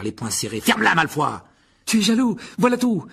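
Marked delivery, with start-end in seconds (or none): 0.6-1.4 loud, raised angry retort. Acted. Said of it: "répliqua Harry avec colère". 1.9-3.1 put-on high, loud boyish outburst; shouted accusation. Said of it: "Lança Colin".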